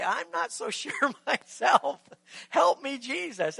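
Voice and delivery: slow, chuckling admission of being lost; sheepish prayer. Retold or imitated. Preacher looks up and admits to Jesus he is lost, slow and laughing. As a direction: laugh at yourself and ask for help.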